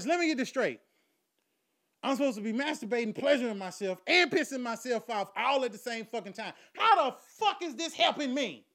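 high, loud, building protest; incredulous outrage. Acted. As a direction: build to an exasperated high shout. Nephew challenges uncle's absurd advice.

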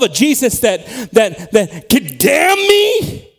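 loud, high, stammering disbelief; incredulous outrage. Acted. Preacher acting one who can't believe Jesus could damn, incredulous outcry. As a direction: stumble on "that", then cry out the last words slowly. Acted outraged objector to damnation.

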